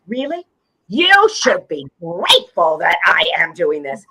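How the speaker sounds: mock-haughty, incredulous reply; a grandly put-out "you owe me"; indignant. Mom answering a critical child about reading, mock indignant.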